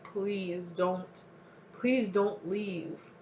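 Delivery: begging. Acted. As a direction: beg them not to walk away. Voicing someone begging them not to leave.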